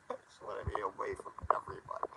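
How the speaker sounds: fast, soft breathless whisper; broken whisper. Real, not acted. Drunk man crying alone on stream, whispered. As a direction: let it slip out as a whisper.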